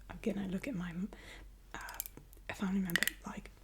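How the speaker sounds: ordinary delivery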